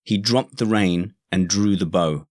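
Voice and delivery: ordinary delivery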